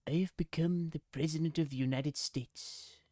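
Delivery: half-successful cartoon old man; Mister Burns. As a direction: announce it as a scheming cartoon tycoon. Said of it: "Do an impression of Mister Burns".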